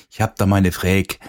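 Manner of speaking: "Fräg" in Vogtland dialect, with ä and no final e. Retold or imitated. DJ mimicking Vogtland speech for listeners, dialect "Fräg". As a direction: imitate a Vogtlander saying "Fräg". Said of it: "Im Vogtland gibt's auch so ihre Weltsprachen".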